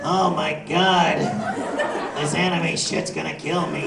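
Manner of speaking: slow, laughing clown groan; Krusty the Clown. Retold or imitated. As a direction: drag it out like a tired clown. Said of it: "I sound like the clown on".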